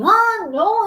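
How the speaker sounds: high, loud mock wailing; crying child. A narrator's reading. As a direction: whine it out like a bawling boy. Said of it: "He went on crying".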